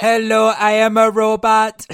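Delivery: loud, high robot voice; booming metallic robot. Retold or imitated. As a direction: bark it out like a cheesy robot. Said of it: "the pretend robot voice he does is terrible".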